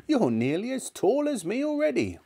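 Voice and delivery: put-on high, complaining old woman; disgruntled grandmother. A narrator's reading. Grandmother scolding George.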